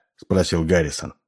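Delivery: ordinary delivery, nothing marked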